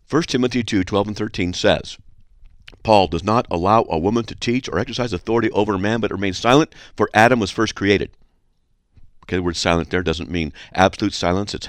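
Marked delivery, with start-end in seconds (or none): 0.1-8.1 firm, matter-of-fact scripture quote; direct, confident declaration in own pitch; straight authoritative reading. Shown as a demonstration. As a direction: state it squarely without softening. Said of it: "or I could say like this".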